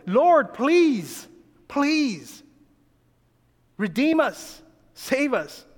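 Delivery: high, imploring prayer; pleading. Retold or imitated. Preacher voicing the people begging the Lord, pleading. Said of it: "this is what they're pleading for".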